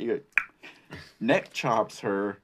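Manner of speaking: ordinary talk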